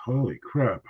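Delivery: hoarse after choking. Real, not acted. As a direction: voice strained from choking. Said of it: "Went down the wrong pipe".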